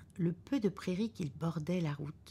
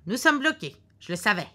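a loud, higher cry of triumph; exclaiming; 5 semitones higher, 9 dB louder than usual. Acted. Husband crying out that the car is stuck, loudly. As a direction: announce the bad news with relish. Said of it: "s'écria monsieur Foster".

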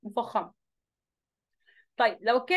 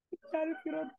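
teasing laughter; 4 semitones higher, 9 dB quieter than usual. Real, not acted. Teasing caught student, laughing.

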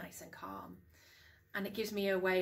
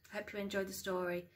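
ordinary delivery, nothing marked

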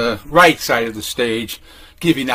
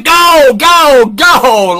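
shouted high and loud; crowd yelling go; 11 semitones higher, 8 dB louder, much slower than usual. Retold or imitated. Reading crowd shouting at Ginsberg, loud cheering yell. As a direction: cheer them on like a jam session crowd.

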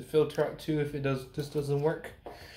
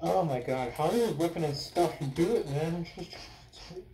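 muffled behind mask; straining while tightening straps; through a gas mask. Real, not acted. Seen 1: Gas mask worn over face while tightening straps throughout.

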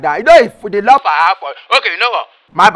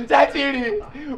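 very slow, stretched word; long, slow quiet moan; drawn-out lament; 3 semitones higher, 7 dB quieter, much slower than usual. Acted. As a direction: drag "Sentiri" out, softer than full voice. Dejected suitor repeats, drawn out.

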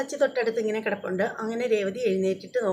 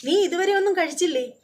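angry scolding; 7 semitones higher than usual. Retold or imitated. Sachi scolding Revathi for not eating, raised angry voice. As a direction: scold her for skipping food. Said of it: "ക്ക് ദേഷ്യമാവാണ്".

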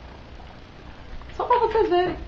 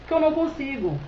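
usual speaking voice

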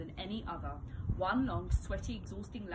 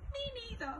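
high, sniffly small voice; mopey little brother sniffling; 14 semitones higher than usual. A narrator's reading. Peter answering Mum, high sniffly voice.

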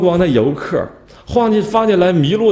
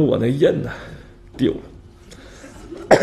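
sheepish whispered confession; 5 dB quieter, much slower than usual. Retold or imitated. Minister confesses lost seal.

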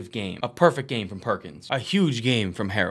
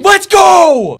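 much higher, much louder cheer; real joyful yell; celebratory shout; 17 dB louder than usual. Real, not acted. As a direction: let it rip in celebration. Celebrating a big play.

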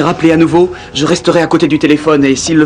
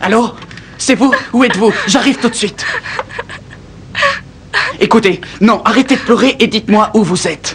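urgent and worried; 7 semitones higher than usual. Acted. Prêtre pressé, inquiet.

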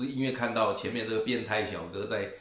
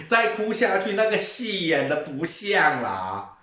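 much higher, slow sneer; mocking; 8 semitones higher, 7 dB louder, slower than usual. Real, not acted. Sneering at the crying man, much higher and slow. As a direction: sneer that the act is unconvincing.

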